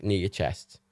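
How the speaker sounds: ordinary talk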